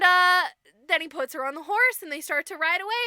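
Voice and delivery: usual speaking voice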